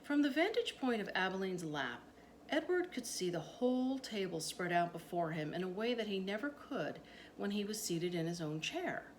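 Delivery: usual speaking voice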